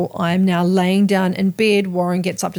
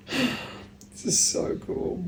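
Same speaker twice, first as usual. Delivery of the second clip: awed whisper; 3 semitones higher, 9 dB quieter, much slower than usual. Real, not acted. Awed after channeling.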